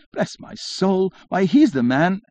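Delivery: high, slow, amazed cry; astonished exclamation. A narrator's reading. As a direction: gasp it out in wonder. Excited exclamation in quoted dialogue.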